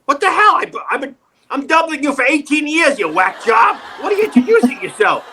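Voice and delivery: high-pitched comic exasperation; hammed-up indignant protest; mock outrage. Acted outraged reply to Phil.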